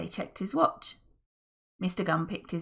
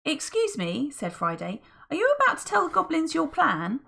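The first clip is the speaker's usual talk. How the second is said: high, fussy interruption; Friday, polite; 9 semitones higher than usual. Acted. Friday questioning Mister Gum's plan, high and polite. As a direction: politely butt in.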